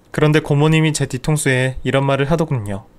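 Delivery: usual speaking voice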